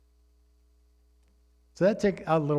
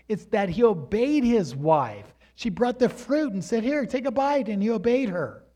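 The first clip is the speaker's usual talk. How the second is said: raised, driving sermon voice; preaching hard; 6 semitones higher, 4 dB louder than usual. Acted. Pastor urging the men to listen to their wives, preaching hard. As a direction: press the point home from the pulpit.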